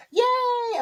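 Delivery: very high mock yay; high cheer mimicking the crowd. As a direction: squeal it like the crowd did. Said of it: "they were like".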